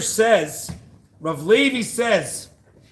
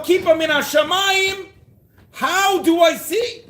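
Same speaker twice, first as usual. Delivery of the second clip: preaching at full force; 7 semitones higher than usual. Real, not acted. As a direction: cry it out to the congregation. Impassioned sermon climax.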